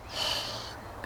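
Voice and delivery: soft, voiceless sigh; long sigh. Acted. Molly alone with her thoughts, soft musing sigh. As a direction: let out a quiet breath of a sigh. Opens the acted soliloquy.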